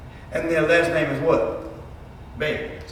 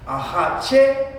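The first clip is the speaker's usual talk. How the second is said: "hache" with its last syllable punched high; stress on final syllable; 7 semitones higher than usual. Shown as a demonstration. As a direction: say "hache" rising to a held, accented final syllable. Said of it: "Is it on the third syllable".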